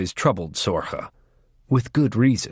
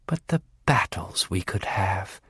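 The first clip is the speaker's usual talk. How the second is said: low, soft, far-off longing; dreamy longing; 4 semitones lower, 6 dB quieter than usual. Acted. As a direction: murmur it softer, lost in a dream of war. Bonanak longs for war.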